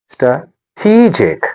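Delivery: "Strategic" slowly split, stra... TEE-jic; stress on second syllable. Shown as a demonstration. Teacher breaking the word up, "Strategic" as stra, TEE-jic. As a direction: pause before a long TEE in "Strategic". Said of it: "The stress is on".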